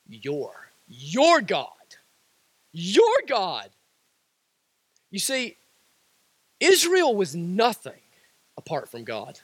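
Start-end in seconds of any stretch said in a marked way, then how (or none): 0.1-3.7 Your leaned on, low and weighty; stress on your. Shown as a demonstration. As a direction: press down on Your each time. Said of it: "it's the word your".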